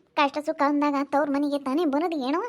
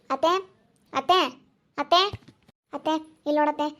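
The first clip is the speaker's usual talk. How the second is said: calling out. Acted. Neighbor calls out to aunt.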